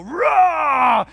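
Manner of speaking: a sudden loud blow-up roar; angry roar. Retold or imitated. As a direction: roar like you just exploded at your wife. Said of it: "I would go".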